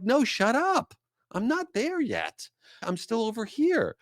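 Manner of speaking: high, pleading no-spoilers whine; exasperated protest. Retold or imitated. To a friend about to spoil the show, high exasperated whine. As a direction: beg them to stop before they spoil it. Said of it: "I'm like".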